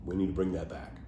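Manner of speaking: ordinary talk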